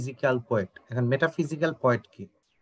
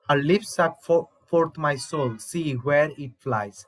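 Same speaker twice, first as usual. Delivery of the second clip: slow recitation; much slower than usual. Acted. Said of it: "এই কথাটা তখন doctor Faustus বলে".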